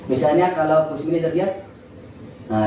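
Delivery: ordinary talk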